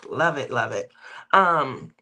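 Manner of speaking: ordinary talk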